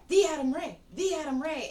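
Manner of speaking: a hint of a gushing groupie; starstruck fan. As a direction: imitate a fan fawning over a celebrity. Imitating starstruck chick.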